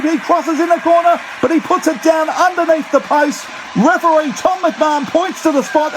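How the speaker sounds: high, fast, frantic try call; radio rugby commentator. Real, not acted. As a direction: scream the try home to listeners. Try scored, commentator erupts.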